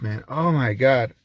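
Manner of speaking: usual speaking voice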